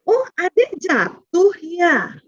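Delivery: very slow, tender reassurance; gentle comfort. Shown as a demonstration. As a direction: soothe a child who fell. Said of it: "Itu latihan aja". (menghibur anak yang jatuh dengan lembut)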